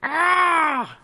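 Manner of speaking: high shrill dragon screech; dragon roar impression. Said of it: "That's my impression of a dragon".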